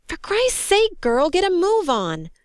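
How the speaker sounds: high, exasperated yell; frantic urging. A narrator's reading. Crewman yelling at the soldier to move, frantic urging. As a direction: hurry her up with a pleading shout. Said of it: "The crewman gestured frantically at her".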